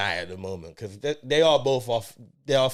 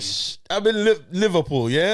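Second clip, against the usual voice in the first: higher-pitched mock Scouse; attempted Scouse accent; 5 semitones higher, 3 dB louder than usual. Host insisting to co-host he is in Liverpool, attempted Scouse accent. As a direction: defend it in a bad Scouse accent. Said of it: "On Scouse Street".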